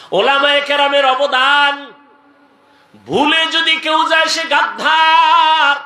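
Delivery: high ringing shouts, then a rapid-fire list stepping down; fiery sermon delivery; full-force preaching. Acted. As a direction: cry out to the crowd with stretched endings.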